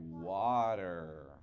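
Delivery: usual speaking voice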